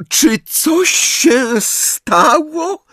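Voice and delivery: high, slow, quavering cry; trembling shrill Trelawney. A narrator's reading. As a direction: quaver it, barely holding back tears. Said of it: "rozdygotanym głosem". (rozdygotany głos, wysoko i powoli)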